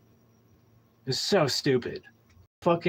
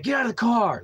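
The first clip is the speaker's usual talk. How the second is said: higher, sharp command; intense cop order; 10 semitones higher, 3 dB louder than usual. Retold or imitated. Cop ordering the driver out of the car, harsh command. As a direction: bark the order like an angry cop. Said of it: "it was intense".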